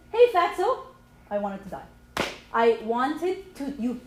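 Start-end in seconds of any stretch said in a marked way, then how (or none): 0.1-0.8 lightly mimicked loud, high greeting; retold hearty hail, pitched up; brash greeting. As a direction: call it out like a cheeky buddy. Retells husband greeting his best friend.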